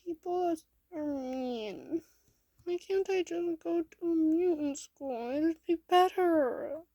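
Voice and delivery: put-on high, loud, whiny child's cry. A narrator's reading. Bullied kid on Mikey's shoulder pleading for mutant school, crying. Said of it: "mumble out as you cry".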